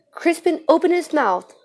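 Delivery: usual speaking voice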